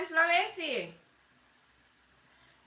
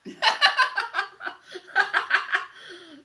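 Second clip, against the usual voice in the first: loud laugh; 6 semitones higher, 7 dB louder than usual. Real, not acted. Bursts out laughing at comment.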